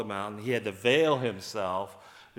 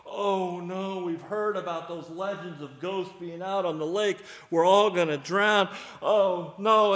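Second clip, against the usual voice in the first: mock panic; 7 semitones higher, 6 dB louder than usual. Disciples panic at a ghost.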